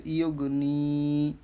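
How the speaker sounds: slow ইউগ নি, long sustained নি; drawn-out vowel. Shown as a demonstration. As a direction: stretch the নি of ইউগ নি. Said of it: "যেখানে টান দিতে হয় শুধু টান দিলাম". (ইউগ নি, নি টেনে পড়া)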